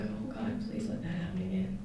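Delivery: very soft pleading; soft whispered plea; hushed prayer. Retold or imitated. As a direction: barely breathe the prayer.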